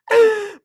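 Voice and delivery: high burst of laughter; real amused laugh; short laugh. Real, not acted. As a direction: let out a quick cackle. Laughing at the story.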